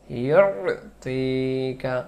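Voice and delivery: ইউতিকা with a long stretched ইউ; slow Quranic drawl on ইউতিকা; drawn-out recitation. Shown as a demonstration. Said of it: "এটা বারবার পড়ে নিয়েন".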